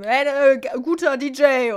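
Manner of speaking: put-on loud, high drunken-party shout to the boss; acted yell of praise to the DJ; retold call-out. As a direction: call it across the room, stumbling. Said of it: "meinem Chef zugerufen".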